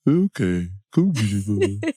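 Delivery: slow, lightly voiced surrender to the craving; giving in to temptation. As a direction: give in to the food, drawn out and coy. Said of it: "And sometimes I go".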